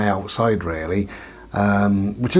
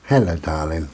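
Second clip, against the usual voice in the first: cooing to dog; 5 semitones lower than usual. Real, not acted. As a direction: coo at the dog gently.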